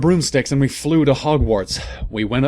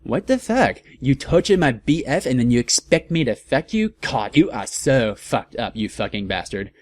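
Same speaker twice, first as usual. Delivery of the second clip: mock angry. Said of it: "I said angrily".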